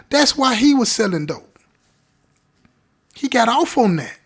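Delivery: high, loud, emphatic insistence; impassioned. Real, not acted. Storyteller revealing why he really sold dope, real vehemence. Storyteller driving home his point.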